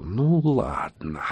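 slow, soft, drawn-out «Ну ладно»; softly drawled, ominous mutter. A narrator's reading. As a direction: stretch «Ну ладно» out quietly. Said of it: "пробормотал Макс".

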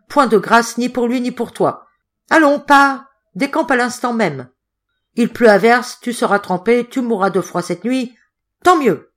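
high, loud, merciless tirade; furious refusal. A narrator's reading. Hotelier banishing the boy into the rain, furious. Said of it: "d'une voix sifflante tant il avait les dents serrées".

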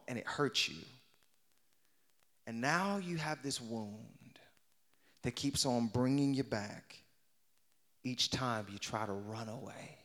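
hushed and tender, drawing them in; quiet preaching. Acted. Pastor gently comforting the hurting congregation, soft and low.

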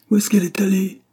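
high, loud, urgent question; crying out. A narrator's reading. Husband looking round for his missing wife, crying out. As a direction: call it out in alarm, pitch way up. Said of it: "cria Jacob".

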